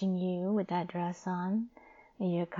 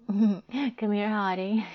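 giggling invitation; laughing; 3 semitones higher, 7 dB louder, much slower than usual. Acted. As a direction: laugh through the words. Laughing playful call.

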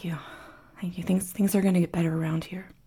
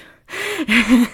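louder delighted laugh; excited laugh; 2 semitones higher, 12 dB louder than usual. Acted. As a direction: giggle with excitement. Laugh in scene.

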